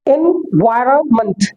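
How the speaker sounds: "एनवायरनमेंट" slowly as en-VAI-ra-munt; slow syllables in "एनवायरनमेंट", weak ending; correct pronunciation, broken up. Shown as a demonstration. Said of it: "इसकी correct pronunciation क्या है". ("एनवायरनमेंट" को धीरे से एन-वायर-मंट)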